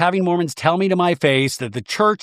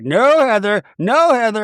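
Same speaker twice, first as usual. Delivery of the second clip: louder, higher insistent protest; mock protest; 6 semitones higher, 5 dB louder, slower than usual. Meredith protesting to Heather, loud and high mock protest. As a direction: raise it up to cut Heather off. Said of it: "Meredith's like".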